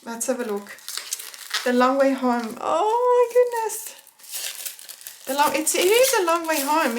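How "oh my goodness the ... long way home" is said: real tears breaking through the words; tearful, moved. Real, not acted. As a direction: voice cracking high with tears. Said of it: "I'm gonna cry".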